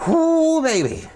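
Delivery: real thrill, a big high cheer; excited whoop. Real, not acted. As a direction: whoop it up over the food. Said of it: "I get excited when I'm making good food".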